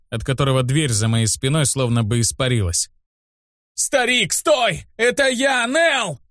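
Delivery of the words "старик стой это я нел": очень высокий отчаянный крик; кричи в страхе, умоляя остановиться; испуганный крик. A narrator's reading. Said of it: "Не помня себя от страха, закричал я". (высокий крик от страха, без настоящей громкости)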